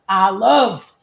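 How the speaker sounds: cheerful sassy boast; playful excitement. Real, not acted. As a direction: brag happily about loving to talk. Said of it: "I love yapping my mouth".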